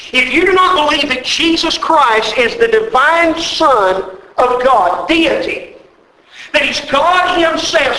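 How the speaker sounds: slow, earnest pulpit preaching; fervent sermon delivery. Acted. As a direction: drive the doctrine home from the pulpit. Preacher declares doctrine.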